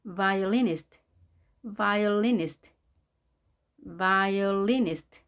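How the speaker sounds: violinist drawn out slowly, the stress on vi, the end falling. Shown as a demonstration. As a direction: spell out violinist slowly for the class. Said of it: "강세가 여기 있는 게 보이죠?".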